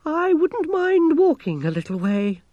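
very high, loud quavering wail; quavered. A narrator's reading. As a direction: wail it out in fright. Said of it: "Gerda quavered".

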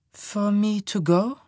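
slow, high confused question. A narrator's reading. Woman repeats her lover's words, confused and high. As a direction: echo it slowly, pitched high, not understanding.

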